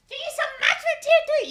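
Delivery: very high, squeaky shout; squealed thanks. Pretend. Streamer thanking a subscriber, high squealing shout. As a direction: squeal it at the top of the range.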